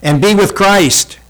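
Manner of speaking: high, loud preacher's climb; fervent preaching. Acted. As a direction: preach it full out from the pulpit. Preaching at full force.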